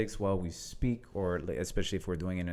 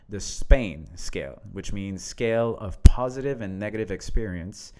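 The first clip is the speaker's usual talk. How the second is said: reading a post aloud; 5 dB louder than usual. Acted. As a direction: lean into each line as you read it out. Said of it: "I will read this".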